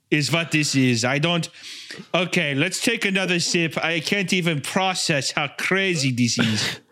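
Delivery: put-on German-accented bemused reviewer; mildly amazed, halting influencer patter; Hitler as taste-test vlogger. Vlogger reviewing tapioca balls, German-accented bemusement.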